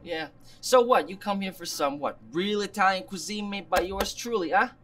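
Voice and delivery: chummy put-on Italian-American accent; phony Italian-American goodfella talk; fake Italian accent. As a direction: play the Italian restaurateur, accent and all. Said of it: "Not stupid fake accent".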